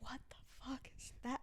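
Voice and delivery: hushed, breathless whisper of alarm; frightened whisper. Retold or imitated. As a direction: whisper it as if the ground is moving. Ground shaking, characters in panic.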